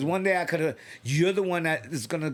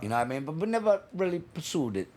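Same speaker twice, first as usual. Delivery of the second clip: put-on Jamaican accent; 5 semitones lower than usual. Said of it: "in a Jamaican accent".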